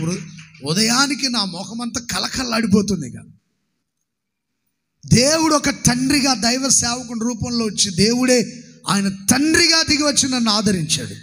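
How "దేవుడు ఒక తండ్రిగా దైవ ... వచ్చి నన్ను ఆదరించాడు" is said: rising sermon climax. Acted. Pastor proclaiming God comforted him, building preaching voice. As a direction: preach it higher and fuller.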